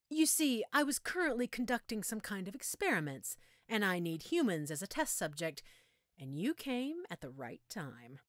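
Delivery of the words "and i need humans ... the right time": usual speaking voice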